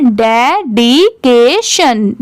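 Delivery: डेडिकेशन slow, with gaps between de, di, ke, shan; syllable by syllable. Shown as a demonstration. Teacher leading a repeat-after-me drill, डेडिकेशन in slow syllables. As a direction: stretch each syllable of डेडिकेशन. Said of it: "ऐसे आप करके मेरे बाद repeat करिए".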